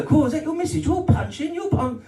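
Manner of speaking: high, jeering bloke voice; mocking bloke. Strangers jeering at the comedian about his girlfriend, high and mocking. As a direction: needle him like a lad in the pub. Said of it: "Everywhere we go".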